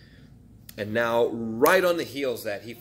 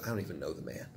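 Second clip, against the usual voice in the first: hushed denial; 8 semitones lower, 14 dB quieter, much faster than usual. Retold or imitated. Peter denying Jesus.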